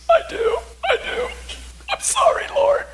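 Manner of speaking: very high, squeaky imitated wail; mock bawling. Bawling wife repenting to the Lord, mock sobbing. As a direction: bawl it in an exaggerated high cry. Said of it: "she's bawling".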